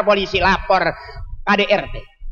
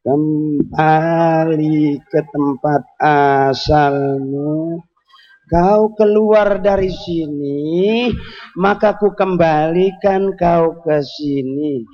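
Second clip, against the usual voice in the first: slow, drawn-out spell chanting in a lower voice; mock incantation; 5 semitones lower, much slower than usual. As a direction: chant it like a shaman casting a spell.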